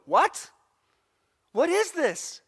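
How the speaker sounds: high, loud astonished cry; John, stunned by the vision, cries out high and loud. Acted. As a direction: gasp it out as if seeing a monster.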